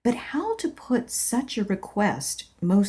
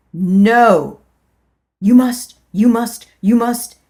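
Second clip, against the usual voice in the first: loud, higher repeated demand; insistent command; 3 semitones higher, 10 dB louder than usual. Acted. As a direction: hammer the words home. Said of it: "came the strong current of a feeling".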